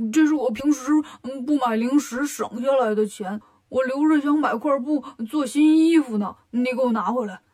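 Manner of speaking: protesting pig character voice; Bajie, aggrieved pig-man. Acted. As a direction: whine for your savings back, indignant. Bajie protests over his money.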